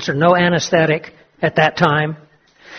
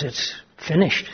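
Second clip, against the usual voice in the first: quiet grim; 3 semitones lower, 10 dB quieter than usual. Acted. Doctor's grim quiet answer.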